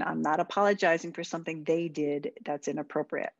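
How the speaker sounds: normal talking voice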